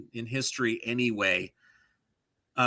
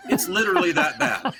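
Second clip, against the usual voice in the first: laughing; 6 dB louder than usual. Real, not acted. Laughing at bad voice.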